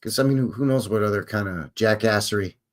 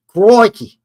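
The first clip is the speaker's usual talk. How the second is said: Crikey shouted high and loud in a hammed-up Australian accent; loud hammed-up Aussie Crikey; loud mock Australian exclamation; 9 semitones higher, 11 dB louder than usual. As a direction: belt out Crikey like an Aussie bushman.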